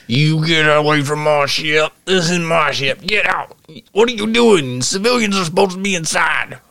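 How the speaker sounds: the man guarding his ship, scolding the climber; Southern NPC. Acted. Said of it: "And he's like".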